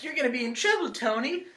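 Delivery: very high-pitched mock scolding; Pepper. Co-host predicting the trailer as Pepper, high mock warning. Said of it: "Pepper's gonna be like".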